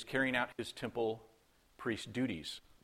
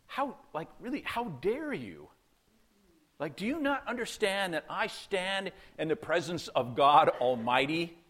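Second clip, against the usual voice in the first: indignant, higher-pitched rebuke, swelling at the end; Gabriel; 4 semitones higher than usual. Retold or imitated. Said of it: "it's almost as though Gabriel's like".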